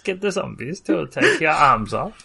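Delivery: a hint of an Arabic-accented villain; lightly Arabic-accented villain threat. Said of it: "Which is why I was doing an Arabic accent".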